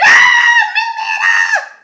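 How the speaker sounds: full-out high, loud reenacted scream; piercing horrified scream, held long. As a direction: shriek the child's name in horror. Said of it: "I screamed".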